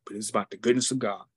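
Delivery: ordinary delivery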